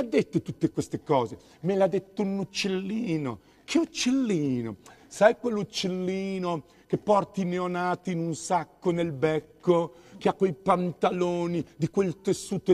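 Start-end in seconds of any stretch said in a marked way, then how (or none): none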